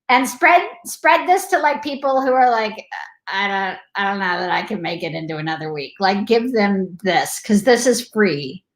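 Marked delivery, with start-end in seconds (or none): 3.3-5.9 low, soft, quick murmur of doubt; mock discouraged. Imitating people who may not make another week, quietly discouraged. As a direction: voice someone quietly losing hope.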